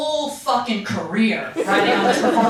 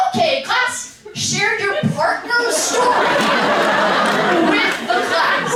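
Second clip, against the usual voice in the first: much higher, slow sing-song teacher; chirpy classroom announcement; teacher calling the class to attention; 10 semitones higher, 4 dB louder, slower than usual. Retold or imitated. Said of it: "the teacher goes".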